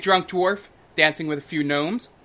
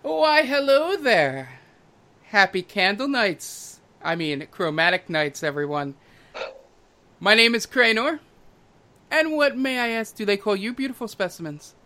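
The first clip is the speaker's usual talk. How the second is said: flamboyant half-elf host; 6 semitones higher than usual. Acted. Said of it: "and he goes".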